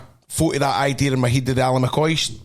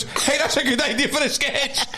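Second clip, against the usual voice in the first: much higher, squeaky imitation of the young attendant; excited petrol guy; 13 semitones higher than usual. Teller imitating the petrol station boy, high and eager. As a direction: play the starstruck kid at the till.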